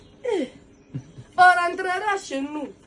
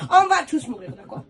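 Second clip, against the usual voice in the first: scolding; 2 semitones higher, 8 dB louder than usual. Retold or imitated. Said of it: "Elle me dit".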